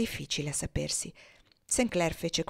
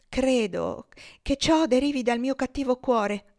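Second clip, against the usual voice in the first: put-on slow, solemn, higher child's answer; gravely; 6 semitones higher, 5 dB louder, slower than usual. Topsy answering her master gravely, slow and solemn. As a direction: confess it with mock solemnity. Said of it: "rispose quella gravemente".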